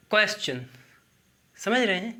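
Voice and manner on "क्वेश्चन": क्वेश्चन repeated with a clear ch; teaching pronunciation. Shown as a demonstration. Teacher repeats the word for the class. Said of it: "क्या है?".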